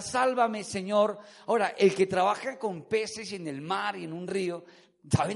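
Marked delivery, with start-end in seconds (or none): none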